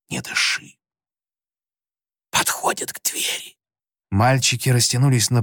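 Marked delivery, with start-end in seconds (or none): 0.1-3.6 breathless frightened whisper. A narrator's reading. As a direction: barely breathe it out. Footsteps coming to the door. (едва слышный испуганный шёпот)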